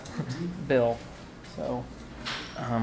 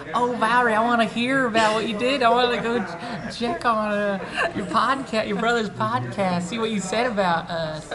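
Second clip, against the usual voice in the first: high, chirpy imitation of eager Cleveland fans; Cleveland locals; 9 semitones higher than usual. Mocking the Cleveland people who follow the podcast, high and eager. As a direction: play the nosy Cleveland folks, pitched way up. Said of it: "The people in Cleveland are like".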